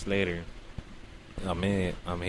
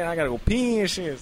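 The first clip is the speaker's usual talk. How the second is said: ordinary delivery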